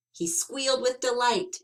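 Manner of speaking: ordinary delivery, nothing marked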